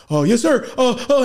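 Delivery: high, loud flustered compliance; mock panic. Scared dad answering the stepdad, mock panic. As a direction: cry out in comic alarm.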